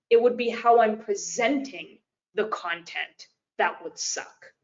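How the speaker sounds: normal talking voice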